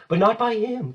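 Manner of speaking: high, loud cut-in; urgent interjection. Acted. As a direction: jump in to shield him, high and loud. Gertrude jumps in protecting.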